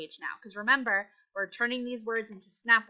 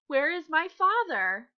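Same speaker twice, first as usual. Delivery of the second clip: higher, slow worried question; scared; 6 semitones higher, 4 dB louder than usual. Acted. Teacher rereading the bear's question, scared. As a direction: ask it anxiously, drawing it out.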